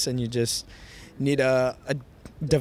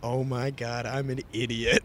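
low-key, softer self-reproach; sheepish realisation; 3 semitones lower, 7 dB quieter, slower than usual. Retold or imitated. Hat owner spotting his own misspelling, quiet sheepish realisation. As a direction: let it sink in quietly, reenacting the moment.

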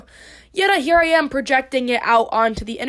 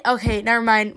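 ordinary talk, nothing marked

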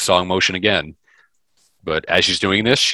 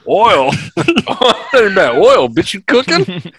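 high, loud, slowed silly voice through laughter; laughing mock voice; 13 semitones higher, 6 dB louder, slower than usual. Joking with friends about the word oil, laughing squeaky voice. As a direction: goof around in a squeaky joke voice.

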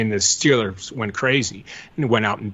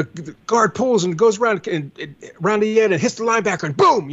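lightly imitated excited play analyst, higher pitched; John Madden; 10 semitones higher than usual. Recalling a coach's later broadcasts for podcast listeners, lightly mimicked commentary. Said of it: "He wasn't broadcasting".

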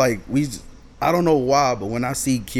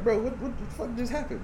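high, bewildered question; 12 semitones higher, 4 dB quieter than usual. Retold or imitated. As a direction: ask stunned, pitching way up. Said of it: "looking at each other like".

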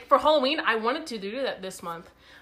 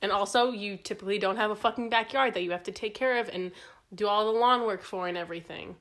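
ordinary talk, nothing marked

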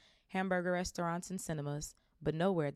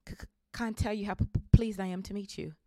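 stammering; 4 semitones higher, 5 dB louder than usual. Acted. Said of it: "stammered Professor Quirrell".

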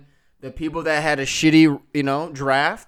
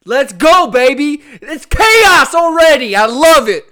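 high, loud hype yelling; hype shout; 11 semitones higher, 14 dB louder than usual. Acted. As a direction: pump up the crowd.

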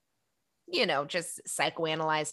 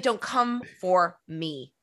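slow sassy; much slower than usual. Retold or imitated. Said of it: "she's like".

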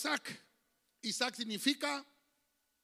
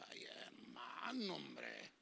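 mocking sigh; 7 semitones lower, 12 dB quieter, much slower than usual. Mock fragile complaint.

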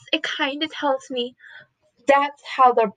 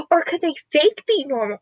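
ordinary delivery

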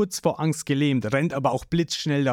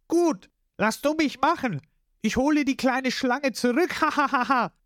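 boastful; 11 semitones higher than usual. A narrator's reading. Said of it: "gibt Lysop an".

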